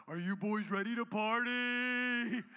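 drawn-out hype-man call; party rallying cry. Acted. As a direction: whip the boys up, stretching the words. Rallying his boys for a party.

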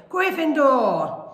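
high, loud, drawn-out announcement; shouted. A narrator's reading. Hat sorting Ron for all to hear, shouted. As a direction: call the house out long and loud. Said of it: "the hat had shouted".

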